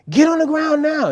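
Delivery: high, loud urgent command; shouted police order. Retold or imitated. Police to protesting suspect, shouting. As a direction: bellow the order as an officer.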